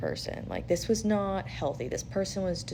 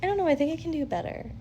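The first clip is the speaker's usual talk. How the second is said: high, fast, sing-song hmm; haughty mock musing; 6 dB louder than usual. Telling the listener how to brush off the ex, high airy sing-song. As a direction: toy with it like you hold all the cards. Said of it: "sit there and go".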